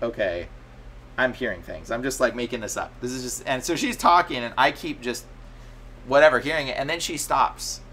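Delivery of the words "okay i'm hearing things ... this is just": low, subdued inner monologue; muted self-talk. Retold or imitated. Man privately doubting he hears things, low and subdued. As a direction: talk yourself down under your breath. Retells telling himself it's imagined.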